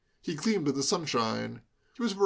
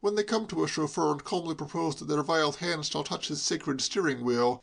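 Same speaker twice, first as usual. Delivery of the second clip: ordinary delivery, nothing marked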